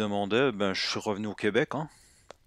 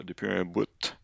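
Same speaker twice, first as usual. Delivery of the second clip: Québécois accent. Shown as a demonstration. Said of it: "c'est l'accent québécois".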